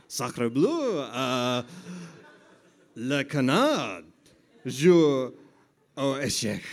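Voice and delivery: loud, slow hammy French; french chef. Pretend.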